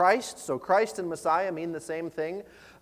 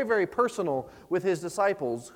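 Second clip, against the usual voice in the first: ordinary talk, nothing marked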